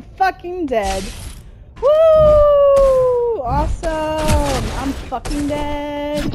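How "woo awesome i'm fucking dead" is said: sarcastic cheer. Real, not acted. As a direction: cheer fake-happy after losing. Sarcastic cheer after dying in game.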